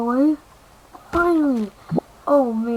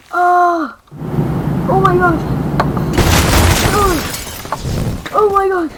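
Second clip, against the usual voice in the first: higher and louder exclaim; loud alarmed oh my gosh; loud panic; 5 semitones higher, 6 dB louder than usual. Real, not acted. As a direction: yell it out in alarm. Panic as game ends.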